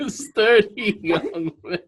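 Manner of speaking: high, slow, through real laughter; amused laughing echo. Real, not acted. Host echoing guest's phrase, laughing helplessly. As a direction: repeat it while cracking up.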